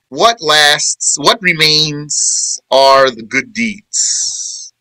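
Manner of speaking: slow, deliberate gloss of the verse; slow explaining voice; slow translation. Acted. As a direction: lay each word down slowly.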